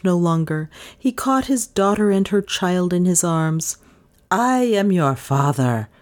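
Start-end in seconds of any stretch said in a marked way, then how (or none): 4.3-5.9 put-on slow, understated cry from old Rip. A narrator's reading. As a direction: declare it slowly, with restrained feeling. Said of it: "cried he".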